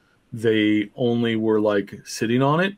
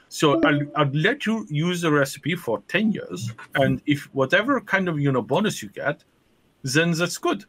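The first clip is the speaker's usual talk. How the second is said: higher-pitched mock German salesman; German accent; 5 semitones higher than usual. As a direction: pitch your cake in a hammed-up German accent. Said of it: "So there's a German who shows up".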